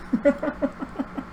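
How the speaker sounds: cracking up high; real high-pitched laugh; high burst of laughter. Real, not acted. Laughing at friend's answer.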